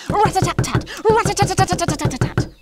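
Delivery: high, loud rapping knock noise; knocking sound. A narrator's reading. Narrator imitates the powerful knock at the door, loud. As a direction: rap out the knock hard and fast.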